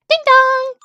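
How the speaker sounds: high, loud sung-out doorbell; high two-note chime; doorbell imitation.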